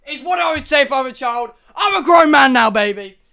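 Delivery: shouting that he's a grown man now; loud declaration. Real, not acted. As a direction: boom it out, proud grown man. Bursting back out of the kid voice.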